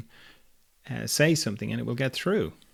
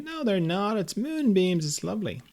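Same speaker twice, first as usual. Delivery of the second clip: high, sing-song denial; hammed-up naive sweetness, pitched up; fluffy-game player contradicting; 8 semitones higher than usual. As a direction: blithely wave the danger away. Blissful player contradicts the gamer.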